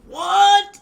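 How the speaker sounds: high, loud disbelieving what; shocked. Retold or imitated. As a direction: blurt it out in shock. Hearing Ray was fired.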